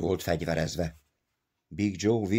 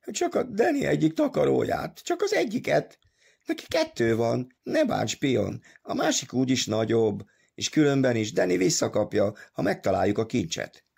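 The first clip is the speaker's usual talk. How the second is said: higher-pitched, whimpering excuse; 7 semitones higher than usual. A narrator's reading. Big Joe excusing his theft to Pion, whimpering. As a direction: plead in a tearful, put-on high voice. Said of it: "Big Joe elpityeredett".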